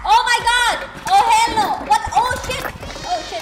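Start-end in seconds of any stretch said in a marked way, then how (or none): 0.1-2.0 shouting. Real, not acted. Sudden jump scare in game. 2.1-2.6 sharp, loud, high cry of fright; startled shout. Real, not acted. Startled in game, said twice.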